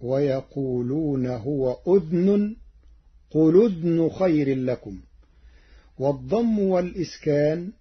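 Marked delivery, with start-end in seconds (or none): 0.0-5.0 أذن with a silent dhal, udhn; Warsh reading of أذن. Shown as a demonstration. Teacher demonstrating the Warsh form أذن. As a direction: say أذن as udhnun, dhal without vowel. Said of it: "فتصير".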